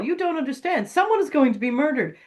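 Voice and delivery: high-pitched, pleading insistence; frantic caller. Retold or imitated. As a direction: plead urgently as a caller nobody believes.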